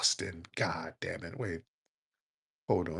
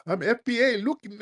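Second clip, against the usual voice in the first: much higher-pitched mimicking voice; mocking caller impression; 13 semitones higher than usual. Host imitating callers who hide hairlines on camera, mocking high voice.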